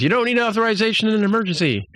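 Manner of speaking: high, fast, frantic squeal; mock panicked protest. Boimler arguing with the computer, mock panicked high protest. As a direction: imitate a panicked man, high and rushed.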